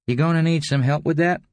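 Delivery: high-pitched, casual character voice; prompting visitor. A narrator's reading. Narrator voices the visiting man.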